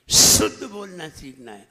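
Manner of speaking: "शुद्ध" launched on a loud whistling sh; demonstrating a forceful hissed start on "शुद्ध"; whistled sibilant. Shown as a demonstration. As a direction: make the sh of "शुद्ध" ring out, then fall away. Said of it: "आवाज देखिए".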